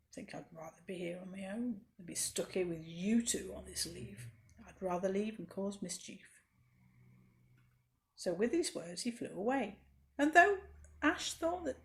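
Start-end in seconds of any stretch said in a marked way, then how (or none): 0.1-6.4 low, soft sulky grumble; moaning mean butterfly. Acted. Mean butterfly sulking at the others, low grumbling moan. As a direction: grumble it out like a spoilt bully. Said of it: "began to moan".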